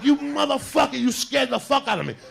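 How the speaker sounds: high, loud, frightened cursing; acted startled outburst. Man scared by the wig, high acted startled yell. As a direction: yell it like you just got a huge scare.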